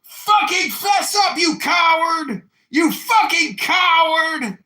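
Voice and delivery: high, drawn-out full-volume yell; acted desperate shouting. Punished recruits demand the culprit confess, shouting desperately. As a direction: scream it slowly as fed-up recruits.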